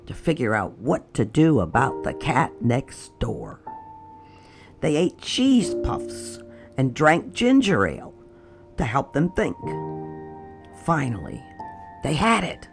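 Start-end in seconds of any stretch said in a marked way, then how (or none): none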